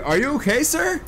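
high concerned call. Real, not acted. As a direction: call out to him, worried. Calling out to a character.

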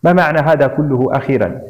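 ordinary delivery, nothing marked

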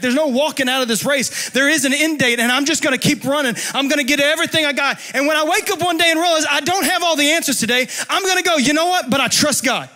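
loud, high, half-sung preacher's appeal; impassioned plea. Acted. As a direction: pour it out in a pleading cry. Closing plea.

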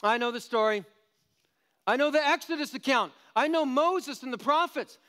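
high, loud mocking know-it-all voice; smug know-it-all. Mocking the self-satisfied believer, high and loud.

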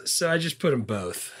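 ordinary talk

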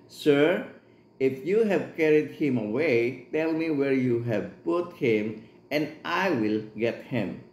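slow, measured Bible reading; scripture reading. Acted. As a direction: read the verse slowly and solemnly. Reads scripture aloud in English.

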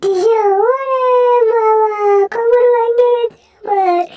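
high, loud wail of mock agony; cartoon cry of pain. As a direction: howl as a cartoon figure with a broken back. Cartoon figure hurt, back broken.

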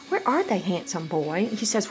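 usual speaking voice

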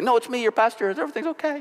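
calm the panicked crowd, lightly hammed; reassuring. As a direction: pastor reassuring the scared congregation, lightly played. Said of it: "I pulled the mask off really quick".

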